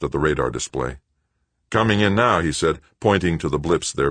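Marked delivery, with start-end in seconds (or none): 1.7-2.4 tense copilot. A narrator's reading. Killefer reporting.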